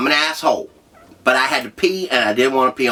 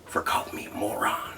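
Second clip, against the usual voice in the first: whisper; 10 dB quieter than usual. Real, not acted. Aside after the story beat.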